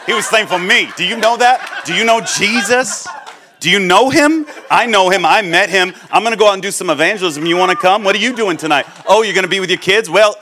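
loud, high, pushy and eager; hammed-up new-convert zeal; zealous new believer. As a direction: corner them like a fired-up convert. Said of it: "they're like, Bro".

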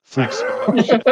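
high, loud squeal of thanks; overjoyed thanks. Acted. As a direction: burst out in delighted gratitude.

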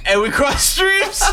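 usual speaking voice

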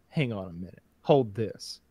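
low, soft, offhand voice; understated aside. Retold or imitated. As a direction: imitate a man quietly handing off his drink. Acts out putting drink down.

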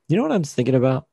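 normal talking voice

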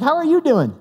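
high, cheery question back; smiling reply. Retold or imitated. Customer won over by the clerk, smiling. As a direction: return the greeting with a big smile. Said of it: "I'm now smiling".

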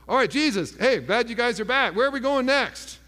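lightly acted upbeat, ready-to-go disciple; breezy eagerness. Disciples packed and greeting Jesus back, breezily eager.

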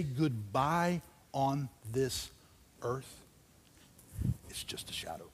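slow, fading into a hushed whisper; gentle whisper of comfort. Real, not acted. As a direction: let the words die away softly. Comforting in grief.

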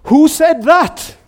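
loud, high, drawn-out call; startled shout. Acted. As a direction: call up loudly to an unseen voice. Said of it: "And he shouts out".

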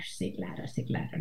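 whispered, puffed-up sí claro; mock the kids lapping up the praise under their breath; smug proud whisper. Mother imitating her proud children in a whisper. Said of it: "se hinchan de orgullo".

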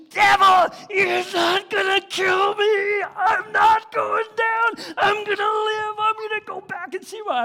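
defiant scream, high and very loud; screaming at the devil. Retold or imitated. Alone in the stateroom, screaming defiance at the devil, high and loud. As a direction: roar your refusal to die at the top of your lungs. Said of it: "I'm just screaming at the top of my lungs".

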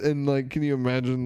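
normal talking voice

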